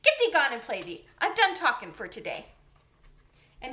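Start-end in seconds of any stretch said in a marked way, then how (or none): 0.0-2.5 cross, loud gardener telling her off; sharp. A narrator's reading. Gardener crossly sends questioning Mary away, loud and sharp. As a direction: snap at her to leave. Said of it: "he said sharply".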